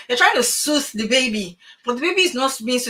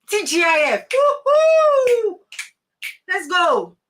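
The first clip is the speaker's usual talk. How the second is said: whooping far higher and louder, hammed-up; mock party cheer; 8 semitones higher, 4 dB louder, much slower than usual. Speaker acts out weekend-crazed workers for her class, hammed-up whooping cheer. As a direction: cheer like it's Friday night at last. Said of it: "You know that thing that you people do?".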